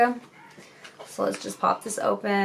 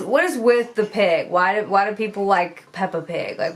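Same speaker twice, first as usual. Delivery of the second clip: ordinary talk, nothing marked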